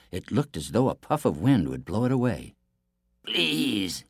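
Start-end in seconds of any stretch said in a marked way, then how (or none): 3.2-4.0 higher, pleading wolf voice; weak wolf. A narrator's reading. Starving wolf begs the prince, weak and pleading. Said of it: "It was so weak and thin".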